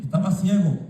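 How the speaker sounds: usual speaking voice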